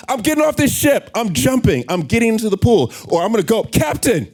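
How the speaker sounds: very high and loud, fed up and demanding; the force. Acted. Speaker acting a passenger who quits the sinking ship, high and loud. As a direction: shout at the captain for your money back.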